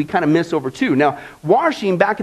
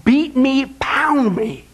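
slow, higher, intense plea; pleading David; 4 semitones higher than usual. Retold or imitated. David begging God for a hard scrubbing, slow and intense. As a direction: beg to be beaten clean. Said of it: "So David's saying".

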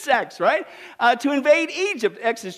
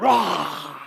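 bear roar; 8 semitones lower than usual. Retold or imitated. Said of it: "And he's like".

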